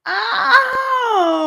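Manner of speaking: high, loud pained yelp; a sharp cry of real pain; cry of agony. A narrator's reading. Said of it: "he cried in horrible agony".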